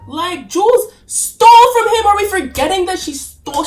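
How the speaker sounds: exasperated outburst. Real, not acted. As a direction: stress it like it's obvious to everyone.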